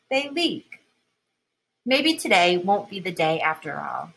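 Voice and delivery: ordinary delivery, nothing marked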